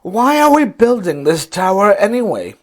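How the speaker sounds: high, loud wondering worker; loud question. A narrator's reading. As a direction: ask it out loud, puzzled. Fat man asks loudly.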